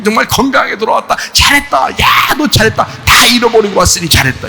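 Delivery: fast, high, loud praise; joyful father. Acted. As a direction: cry out praise to the son. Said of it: "하나님 아버지를 막 주기 시작하는". (아들을 칭찬하며 기뻐 외치는 아버지)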